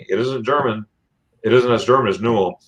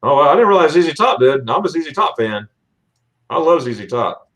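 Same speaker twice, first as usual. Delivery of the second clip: ordinary delivery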